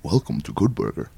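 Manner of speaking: low-pitched dubbed movie voice; Bruce Lee. Pretend. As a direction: parody of English film dubbing. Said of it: "the mouth movements didn't match the words".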